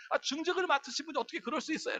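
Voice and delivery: high, hushed mock protest; indignant complaint. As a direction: mimic a scandalised churchgoer. Acted complaint about a church leader.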